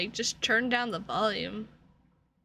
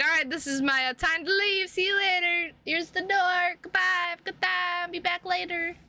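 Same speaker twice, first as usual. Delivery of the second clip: lightly raised, breezy goodbye voice; mock departing friend; 6 semitones higher than usual. Friend [name] bolting from the stream in a mock cheery goodbye. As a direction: hurry out with a quick cheery goodbye.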